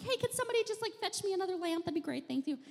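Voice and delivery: high, sugary asking for a lamp; falsely breezy plea in the dark; sarcastic chirpy ask; mock sweet request. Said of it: "I'm like".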